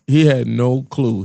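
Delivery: ordinary talk, nothing marked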